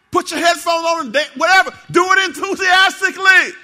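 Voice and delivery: high, very loud exhortation building to a shout; rally the crowd at the top of your voice; full-force enthusiastic preaching. Real, not acted. Preacher urging parking lot ministry workers, shouted enthusiastic preaching.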